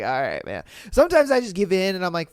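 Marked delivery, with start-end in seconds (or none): 0.0-0.6 resigned giving in. Retold or imitated.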